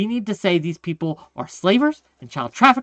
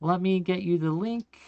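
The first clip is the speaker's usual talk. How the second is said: ordinary delivery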